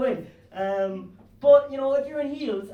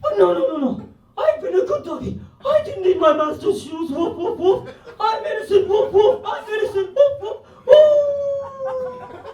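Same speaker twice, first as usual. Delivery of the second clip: high, loud doggy wail with barks; terrified innocent puppy; 11 semitones higher, 7 dB louder than usual. Acted. Puppy pleads innocence in the slaughter prison, frantic wailing. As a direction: plead at a high pitch, ending in a long howl. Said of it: "and he's like".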